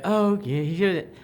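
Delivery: sing-song fake-cheerful mom telling the kids what to do; mock boring responsible mom. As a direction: play the dull sensible mother trope with a lift. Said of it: "Of like".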